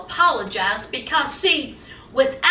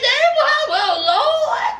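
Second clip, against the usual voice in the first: shocked exclaim; 9 semitones higher, slower than usual. Real, not acted. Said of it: "I said".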